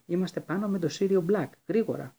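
high, loud call up the stairs; sudden shout. A narrator's reading. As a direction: yell to be found. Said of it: "φώναξε ξαφνικά η Ερμιόνη".

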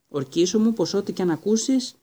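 high, loud pressing demand; urgent plea. Acted. Father begging the boy to swear before the train leaves, high and loud. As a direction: insist he swear it now.

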